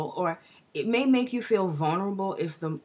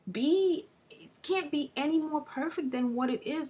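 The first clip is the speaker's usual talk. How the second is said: much higher, bursting with excitement; real excitement; 8 semitones higher than usual. Real, not acted. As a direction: let the thrill lift your voice up high. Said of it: "I'm just so excited about this energy".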